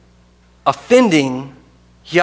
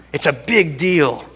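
slowed down, weighty; slow emphatic statement. Real, not acted. Preacher stressing power over life to congregation, slow and weighty. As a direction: lean on it slowly.

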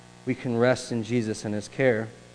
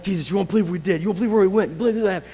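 higher, eager bragging report; excited disciples; 5 semitones higher, faster than usual. Retold or imitated. Disciples telling Jesus their adventures, excited. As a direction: gush like thrilled disciples back from a trip.

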